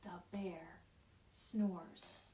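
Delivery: put-on hushed whisper to kids; storybook whisper. Shown as a demonstration. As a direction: whisper so you don't wake the bear. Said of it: "Do you hear the bear?".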